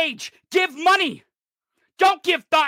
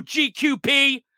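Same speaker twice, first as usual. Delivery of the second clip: normal talking voice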